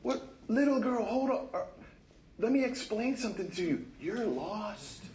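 mock lecture to a crying lost girl; condescending lecture. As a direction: talk down to a lost child. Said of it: "you go up to her and you go".

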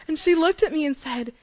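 usual speaking voice

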